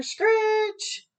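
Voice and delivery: high shrill screech of brakes; piercing brake screech. Acted. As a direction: squeal it out like train brakes.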